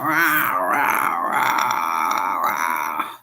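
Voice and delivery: low growling rah sounds; mock stomach growl. Imitating her stomach growling to the chat, low growls. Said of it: "my stomach growling".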